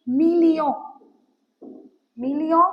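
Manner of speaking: "million" said loud, the French way, ending in a nasal "o"; French pronunciation demo. Shown as a demonstration. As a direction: say "million" slowly and loudly, like a teacher modelling it. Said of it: "देखो मैंने कैसे pronounce किया". ("million" को ज़ोर से, French उच्चारण में बोलो)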